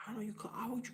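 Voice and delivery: quick whisper to himself; fast whispered self-question; whispered aside. Real, not acted. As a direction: whisper it fast while hunting for the word. Searching for the English word.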